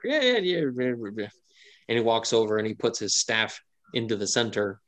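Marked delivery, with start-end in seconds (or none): 0.0-1.3 an old man's eager yeahs, barely put on; old man agreeing. Gnome elder to the party, mild old-man yeahs. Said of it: "an old man is kinda like".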